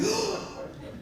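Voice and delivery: sharp high gasp of shock; mortified gasp. Retold or imitated. Lady realizing her gymnast move was a sobriety test, gasping. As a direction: gasp like she just found out. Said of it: "She's like".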